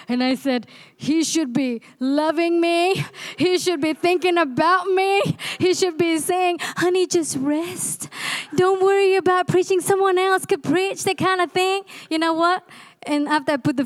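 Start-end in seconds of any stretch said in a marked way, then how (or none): 1.0-11.8 annoyed complaint. Retold or imitated. Preacher retelling her annoyance at her husband, mock-indignant. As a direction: replay the sulk of wanting pity, rising on "me". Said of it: "I was pretty annoyed".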